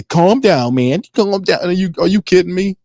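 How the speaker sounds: high, pleading mock calm-down; mock pleading coach. Mocking the coach calming a cursing rival, high and pleading. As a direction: squeak it like a coach backing off.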